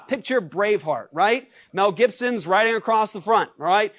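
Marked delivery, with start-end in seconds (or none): none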